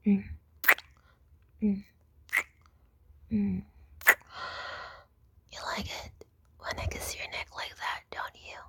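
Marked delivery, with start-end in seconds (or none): none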